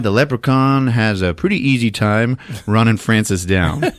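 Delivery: ordinary talk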